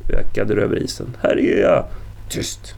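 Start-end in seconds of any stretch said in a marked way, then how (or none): 1.2-1.9 high, loud cry for rescue; raised, far-carrying shout; screamed call. A narrator's reading. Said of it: "Hon skrek i vinternatten". 2.3-2.8 soft, voiceless hiss; sharp whispered hush; hissed order. A narrator's reading. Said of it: "väste Ingvald".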